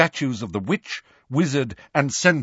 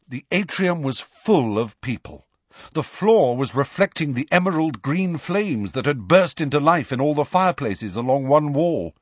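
ordinary delivery, nothing marked

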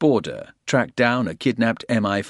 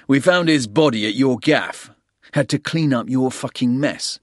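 higher, louder angry; 6 semitones higher, 5 dB louder than usual. A narrator's reading. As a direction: snap at him in anger. Porter angry at Soames.

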